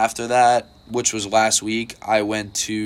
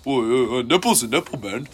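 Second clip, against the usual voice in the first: imitating the guy; 2 semitones higher, faster than usual. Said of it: "He's like".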